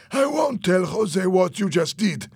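acted heated insistence; agitated insistence. A narrator's reading. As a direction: press the point urgently. Fernando argues at gunpoint.

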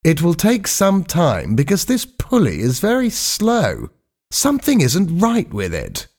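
high-pitched, stalling excuse; unhurried stalling. A narrator's reading. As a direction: make excuses in a high voice, unbothered. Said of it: "said Skink slowly".

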